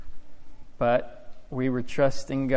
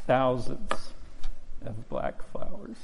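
real tears, voice breaking, slowing to a halt; fighting back tears while telling it; crying; 3 dB quieter than usual. Real, not acted. Farmer telling the church his strawberry crop froze, choking up. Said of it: "Sorry, I'm crying".

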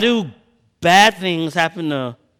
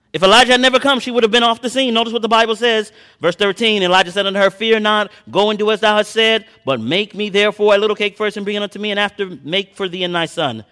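fervent preaching; 6 semitones higher, 4 dB louder than usual. Acted. Preacher urging his congregation, in a raised preaching voice. Preaching and reading scripture aloud.

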